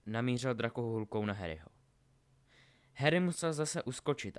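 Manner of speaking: usual speaking voice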